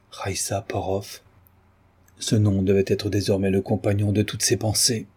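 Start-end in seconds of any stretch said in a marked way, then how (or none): 0.1-1.2 slow, soft murmur to oneself; Valérien repeating inwardly. A narrator's reading. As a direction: let the name sink in, hushed and drawn out. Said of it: "Valérien se répéta en lui-même". (répété tout bas, lentement, pour soi-même)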